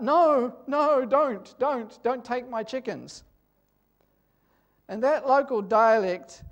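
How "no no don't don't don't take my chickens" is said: higher, louder retold cry of no, don't; calling out. Farmer calling out to the soldier, a retold alarmed cry. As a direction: re-enact the farmer calling out in alarm, easing off at the end. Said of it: "he called out".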